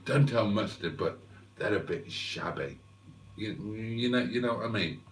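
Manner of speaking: dropped low and soft, as if keeping a secret; quiet conspiratorial confession; hushed aside. Real, not acted. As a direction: lean in and hush it so Mustard won't hear. Secret aside about the caravan.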